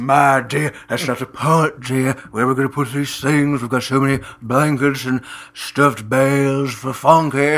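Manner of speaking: put-on fussy husband voice; fretting husband. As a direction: complain to dear as a worried husband. Plays fretting husband in a bit.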